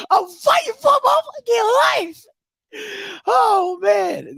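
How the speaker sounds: high, wailing fake cry; hammed-up desperate wail; mock crying. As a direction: sob it out, hammed up.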